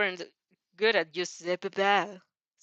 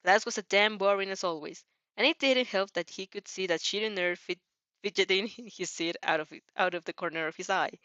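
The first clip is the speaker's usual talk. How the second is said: plain reading voice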